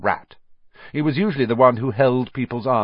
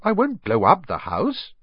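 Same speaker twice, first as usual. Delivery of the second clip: put-on higher-pitched boy's protest; 10 semitones higher than usual. A narrator's reading. Harry protests to snarling aunt.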